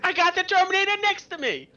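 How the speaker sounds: loud, very high frantic yell; screaming. Acted. Heckler with the Terminator beside him, screaming. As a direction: scream it at the top of the range. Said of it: "gonna be screaming in the crowd".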